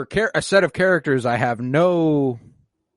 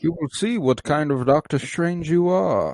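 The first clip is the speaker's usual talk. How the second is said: slow, higher-pitched put-on voice; film character impression; 4 semitones higher than usual. Quoting a film character's line, slow put-on voice. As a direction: draw it out like a movie line.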